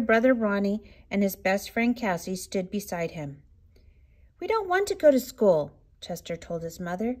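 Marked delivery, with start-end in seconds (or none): 4.4-5.7 put-on high pleading child voice; little cub begging. A narrator's reading. Cubs pleading with mother.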